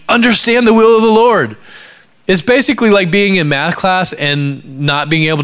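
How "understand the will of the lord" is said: loud, high-pitched order; raised voice, pushed all the way through; blunt command. Acted. Preacher acts out Paul's blunt command.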